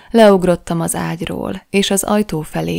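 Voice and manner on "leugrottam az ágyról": ordinary delivery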